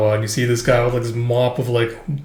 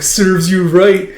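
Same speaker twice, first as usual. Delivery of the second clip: higher, louder, slow gloat; smug gloat; 6 semitones higher, 6 dB louder, much slower than usual. Retold or imitated. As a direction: savor it slowly and smugly. Gloating over her.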